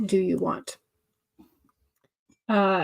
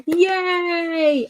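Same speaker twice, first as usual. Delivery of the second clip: little cheer; 9 semitones higher than usual. Real, not acted. Celebrating word count goal.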